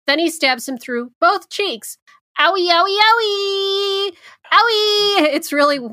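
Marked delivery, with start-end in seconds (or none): 2.4-5.3 high, hammed-up yelps of pain; mock pain cries. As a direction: whimper like a hurt child, playfully. Cheek stabbing in movie recap.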